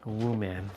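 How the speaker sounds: "Woo man" with the "woo" drawn out, then "man"; woo man pun. Shown as a demonstration. Repeating the pun to himself, "Woo man" split apart. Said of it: "That's a play on words for woman".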